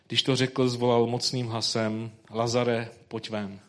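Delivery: solemn public reading of scripture. Acted. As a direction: read the Gospel passage aloud to the congregation. Reads the Gospel passage.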